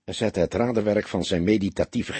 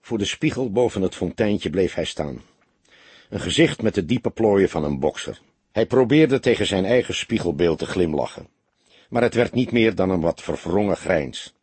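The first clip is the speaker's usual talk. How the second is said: plain reading voice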